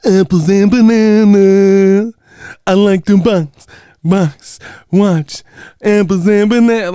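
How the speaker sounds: put-on high, loud, slow children's TV sing-song; mocking a kiddie-show boxing talk; kids show voice. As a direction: sing it like a cheery kids host. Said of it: "I can't talk boxing regular".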